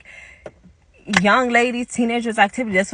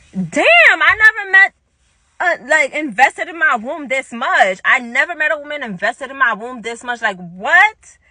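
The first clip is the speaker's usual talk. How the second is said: high, loud, indignant outburst easing slightly; retold exasperation; 5 semitones higher, 4 dB louder than usual. Teller to Diana asking about kids, loud exasperation. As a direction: exclaim it in mock-astonished annoyance.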